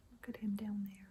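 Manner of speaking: soft unvoiced whisper. Real, not acted. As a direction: lean in and breathe it. Whispered aside to child.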